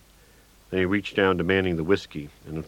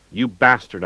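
a louder mock yell, pitch up; 4 semitones higher, 7 dB louder than usual. A narrator's reading. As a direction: lightly yell the insult. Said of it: "I yelled".

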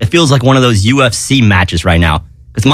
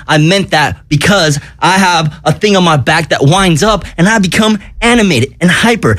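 usual speaking voice

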